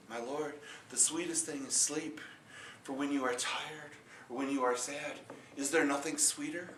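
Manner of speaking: humble farmer. Acted. Farmer gives humble answer.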